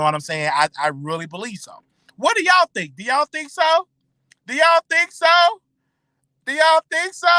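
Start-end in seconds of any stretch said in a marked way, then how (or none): 2.2-7.3 loud and high, calling out to viewers. Real, not acted. Vlogger calls out to his viewers, loud and high. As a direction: call out to the audience, voice raised high.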